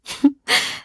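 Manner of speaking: short, airy laugh; modest chuckle. Acted. Guardian laughing off villagers' tales about the shield, modest chuckle. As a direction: laugh off the tall tales lightly.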